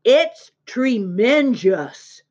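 slow, stretched-out exclamation; amazed boy. A narrator's reading. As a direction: marvel at it, drawing out each word.

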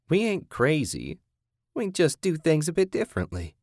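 high-pitched captor voice, defensive; folksy captor. Acted. Kathy justifying herself to her captive, defensive.

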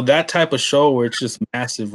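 ordinary talk, nothing marked

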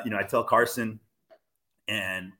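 ordinary talk

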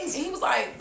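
normal talking voice

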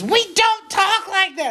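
high, slow mock screech; retold outraged lady. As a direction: imitate a woman scolding at the top of her range. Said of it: "this lady goes".

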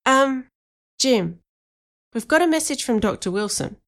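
lightly voiced hesitant kid. A narrator's reading. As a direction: hesitate between words, mildly unsure. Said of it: "Danny hesitated".

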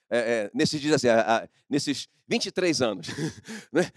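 ordinary delivery

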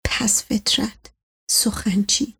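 hushed angry whisper. Acted. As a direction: spit the insults under your breath. Whispered insults in the dark.

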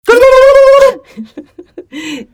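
very high, loud gobbling trill; turkey gobble. Pretend. Podcasters making turkey noises, loud high gobble. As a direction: gobble wildly, breaking into laughter. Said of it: "This is the Turkey Noise Podcast".

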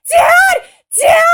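imitating a panicked scream. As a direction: scream for dad from the burning fridge. Veronica locked in burning fridge.

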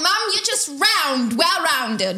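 lightly put-on British consolation; mock British accent. Bridget's child reassures her mum in a light, high British accent. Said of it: "British accent".

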